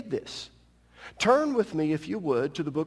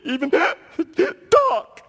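words choked out in a laughing sob; sobbing; 8 dB louder, much slower than usual. Shown as a demonstration. Preacher shows the congregation how sobbing chokes speech. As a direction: let the voice break into a sob-laugh. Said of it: "You get to sobbing so much".

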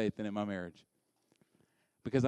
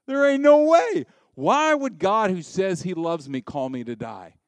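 the flesh protesting; 2 semitones higher, 7 dB louder than usual. Acted. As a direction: protest loudly as the incredulous flesh. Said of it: "The flesh over here goes".

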